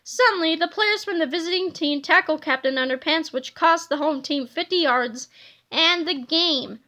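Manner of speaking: usual speaking voice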